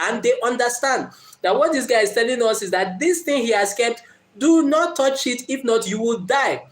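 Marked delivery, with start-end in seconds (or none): none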